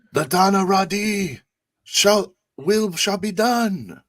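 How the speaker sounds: high, loud, slow solemn declaration; grand proclamation. Acted. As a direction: proclaim it like a decree. Player speaks grandly in character.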